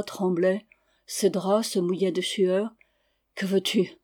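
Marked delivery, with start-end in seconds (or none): none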